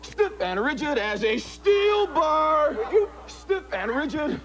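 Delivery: slow, drawn-out stiff and rigid; hypnotic command. Acted. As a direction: stretch out each command. Repeated rigidity suggestion.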